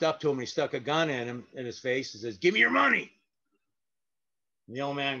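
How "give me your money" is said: threatening demand. Retold or imitated. Robber sticks gun in face.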